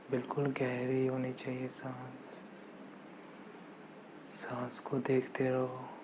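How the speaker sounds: slow, soft, hushed meditation voice; guided meditation. Acted. Meditation teacher pacing listeners' breath in and out, soft slow guided meditation. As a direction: ease each phrase out gently with long silences.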